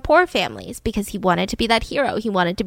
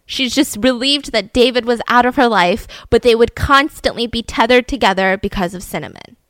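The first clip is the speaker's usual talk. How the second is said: ordinary delivery, nothing marked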